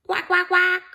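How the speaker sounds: high, loud insistent quacking. A narrator's reading. The duck following Gumboot, a high, loud quack. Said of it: "This time more loudly".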